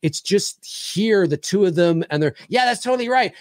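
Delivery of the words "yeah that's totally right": higher, eager mimicked agreement; mock overexcited yes-man; energetic brother impression. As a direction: imitate a hyper interviewee agreeing. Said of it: "very energetic".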